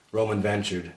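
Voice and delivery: ordinary delivery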